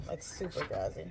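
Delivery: whisper. Real, not acted. Whispered aside about drowsiness.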